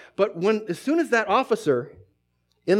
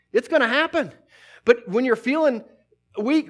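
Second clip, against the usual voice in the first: higher, louder preaching push; fiery preaching; 5 semitones higher, 4 dB louder than usual. Acted. Preacher rallying believers to fight the good fight, fiery. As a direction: drive it home with full force.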